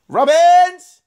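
high, loud playful yell of a name; mock shout. Gamer hailing his next opponent, mock shout. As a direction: belt out the name for fun.

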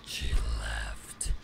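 deep, eerie demon whisper; low, slow whispered demon voice; demonic voice. Acted. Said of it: "deep, eerie voice, that's me".

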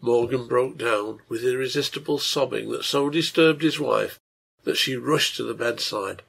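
ordinary delivery, nothing marked

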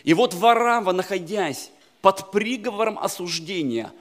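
normal talking voice

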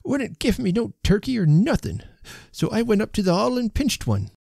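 put-on high-pitched old rustic confessing; croaking old man. A narrator's reading. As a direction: plead your case in a high old man's voice. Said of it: "he croaked".

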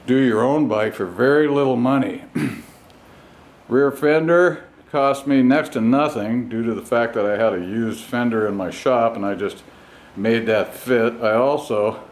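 ordinary talk

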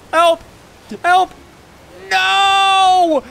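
high, loud cry; shout. Real, not acted. Seeing teammate fall, loud shout. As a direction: yell no.

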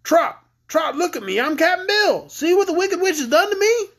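high, urgent little grasshopper voice; small creature calling for attention; crying out. Acted. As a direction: call out shrilly from tiny lungs. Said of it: "cried out".

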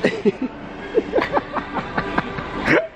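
high breathy burst of laughter; laughing. Real, not acted. Vlogger laughing at friend's joke, high laughter.

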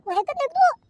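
husband berating his wife at full pitch; furious scolding. Acted. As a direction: tear into her, out of patience.

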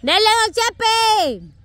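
ánimo estirado y lento; ánimo alargado. Real, not acted. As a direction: alarga el dele para alentar. Animando a don Chepe. (ánimo alargado)

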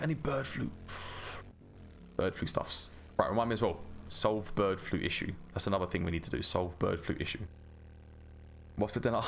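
normal talking voice

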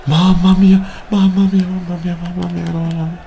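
high, loud cries of despair fading down; anguished wail. Real, not acted. Gamer crying out after his striker misses, anguished. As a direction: wail in disbelief.

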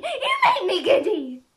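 high, loud screaming irritation; screamed complaint. A narrator's reading. Queen screams at the bowing gardeners. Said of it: "screamed the Queen".

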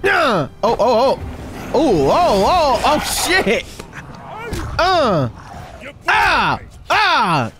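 high startled cries of alarm; startled yelps. Real, not acted. Gamer jumped by a zombie, startled yelps. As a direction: yelp in sudden alarm.

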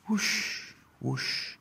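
high airy whoosh fading away; soft wing rush sound effect. A narrator's reading. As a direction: wings rushing past. Pigeons flying overhead.

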